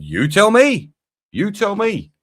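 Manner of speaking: high, loud frustrated outburst; fed-up fan yelling; exasperated yell. Real, not acted. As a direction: shout it at the club in disbelief. Frustrated fan ranting at club.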